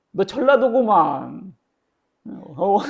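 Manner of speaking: high, loud, slow jeering accusation; a servant teasingly calling out his accent; mocking accuser. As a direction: sneer at him as a Jeolla man, drawn out. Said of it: "다른 사람이 와가지고".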